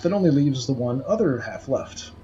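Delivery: normal talking voice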